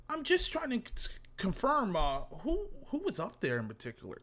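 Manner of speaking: soft, hesitant inquiry sinking from high pitch; imitating a meek detective; mock polite questioner. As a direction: tiptoe through a polite interrogation. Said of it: "you sound like Dick Tracy".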